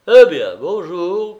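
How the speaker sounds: slow, high, loud hello; effusive boozy welcome; drunken greeting. A narrator's reading. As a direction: swoop tipsily through the greeting. Drunk man greets visitor effusively.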